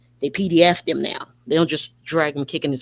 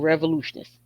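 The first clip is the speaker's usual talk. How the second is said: ordinary delivery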